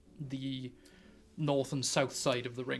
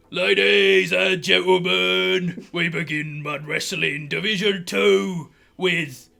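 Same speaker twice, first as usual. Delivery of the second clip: slow, loud, higher announcer boom; ring announcer; 4 semitones higher, 8 dB louder, slower than usual. Acted. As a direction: draw it out like a wrestling MC. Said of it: "he's like".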